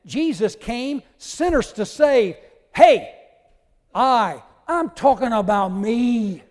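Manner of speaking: loud, high preacher's cry as the apostle; very emphatic. Acted. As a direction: proclaim it at full force, insisting on himself. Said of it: "The Apostle Paul said".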